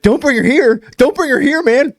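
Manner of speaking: high panicked protest, pitch way up; mock freakout. Dealer refusing his friend on the phone, panicked. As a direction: wave him off in a panic. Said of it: "he's like, ... goes crazy".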